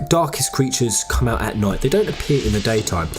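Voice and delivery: ordinary talk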